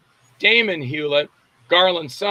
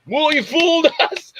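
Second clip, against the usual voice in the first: much higher, laughing through the words; laughing relief; 11 semitones higher than usual. Retold or imitated. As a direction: chuckle out the admission.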